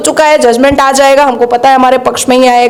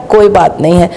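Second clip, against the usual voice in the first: sarcastic dismissal; 6 semitones lower than usual. Retold or imitated. Sarcastic refrain after each charge.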